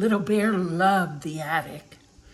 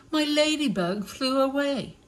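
little bear voice; 7 semitones higher than usual. Acted.

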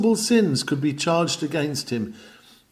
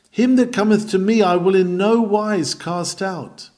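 ringing, raised preacher's proclamation; preaching the text; 6 semitones higher, 4 dB louder than usual. Acted. Preacher proclaiming the promise to sinners, ringing. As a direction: proclaim the promise high and loud.

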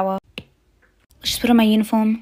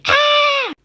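very high-pitched shriek; panicked scream; 4 dB louder than usual. Acted. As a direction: shriek on realising you're late for work. Mother realises she is late for work.

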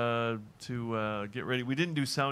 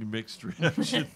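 laughing; much slower than usual. Real, not acted. Repeats the quip, laughing.

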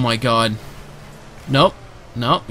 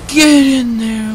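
celebration yell; 9 semitones higher, 6 dB louder, much slower than usual. Real, not acted. Celebrating a goal.

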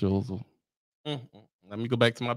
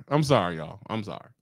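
ordinary talk, nothing marked

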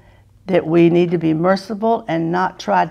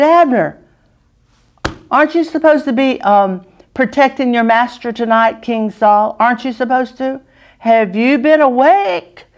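teasing; 6 semitones higher than usual. Acted. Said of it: "he sort of teased him".

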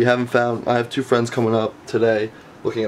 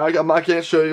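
ordinary talk, nothing marked

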